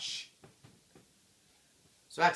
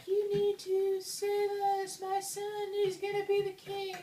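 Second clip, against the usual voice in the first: ghostly queen. Acted. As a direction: plead like a floaty high-pitched ghost. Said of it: "the ghost of the queen is all like".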